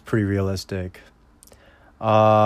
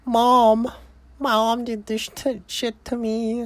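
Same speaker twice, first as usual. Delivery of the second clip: put-on little girl voice; 11 semitones higher, slower than usual. Daughter telling father who tied her, mock high child voice. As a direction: whimper it like a small kid, mocking. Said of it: "she's like".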